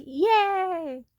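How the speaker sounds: put-on high, loud childlike cheer; cheering little boy. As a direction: imitate a kid who just won. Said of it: "and he's like".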